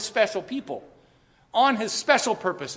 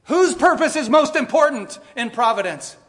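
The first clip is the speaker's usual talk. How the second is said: loud, high call to the congregation; 8 semitones higher, 8 dB louder than usual. Real, not acted. Preacher calling the congregation to say it together, loud. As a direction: rouse the room to answer with him. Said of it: "say it with me".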